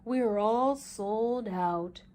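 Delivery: slow, low, flat-sounding clerk; a hint of a shoe salesman, slow; lightly voiced shoe man. A narrator's reading. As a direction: drawl it slowly like a bored shop clerk.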